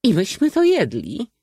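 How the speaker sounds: be appalled out loud; indignant. A narrator's reading. Lady appalled she ate the pineapple, high loud outrage. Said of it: "oburzyła się ciekawa dama".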